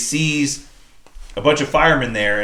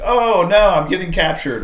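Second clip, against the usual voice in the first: high, louder feigned dismay; badly faked "oh no", pitched way up; mock alarm; 7 semitones higher, 4 dB louder than usual. Hero letting the mob catch him on purpose, mock alarm. Retold staged capture, fake alarm.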